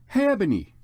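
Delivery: hey Ebony called from a distance. Acted. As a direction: call her name out. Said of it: "shouted a voice".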